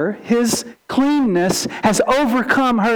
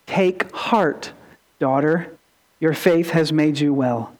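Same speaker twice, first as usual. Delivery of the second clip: tender Jesus; 6 semitones lower than usual. Retold or imitated. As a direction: comfort her like a father. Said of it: "he speaks very tenderly to her".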